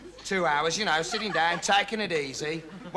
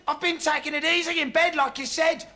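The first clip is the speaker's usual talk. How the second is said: defensive; 9 semitones higher than usual. Acted. Acted defensive answer to boss.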